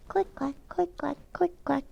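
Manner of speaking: high, soft clicky shoe sounds; light high footstep noise; click clack of heels. Acted. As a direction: tap out the heels with your voice.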